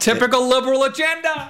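high, laughing mock commentator; mock pundit voice. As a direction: sneer it like a cable pundit.

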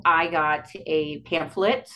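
ordinary talk, nothing marked

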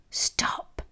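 hissed whisper through gritted teeth; voiceless, urgent hush; hissed warning. A narrator's reading. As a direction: hiss it under your breath.

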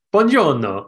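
mock Italian accent. Cast member answering in a put-on Italian accent. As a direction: play a cheesy Italian hello. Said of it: "really good at accents".

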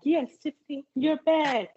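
high, delighted cheer for Tiffany. Retold or imitated. Viewer cheering Tiffany's return, high and delighted. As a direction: welcome her back like a fan. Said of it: "I was like".

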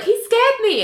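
high-pitched plaintive excuse; mock defensive protest. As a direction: whine it high, imitating self-defence. Nell defends herself in retold quarrel.

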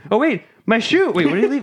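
high, flustered cartoon voice; flustered villain. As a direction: fret over the lost shoe. Willie noticing lost shoe.

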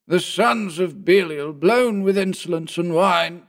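high, slow, grand quotation; declaiming. A narrator's reading. Old Bugs declaiming a quotation at the drinkers, high slow. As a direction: proclaim it like a prophet. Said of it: "shouting various disconnected bits of quotation".

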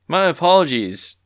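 loud, high-pitched apology from a guard; exclaiming. A narrator's reading. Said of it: "exclaimed the guard".

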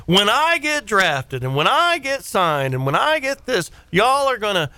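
mock grandiose kid proclamation; cocky kid's boast. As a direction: imitate a boy bragging about his future stardom. Voicing his boastful football-mad kid.

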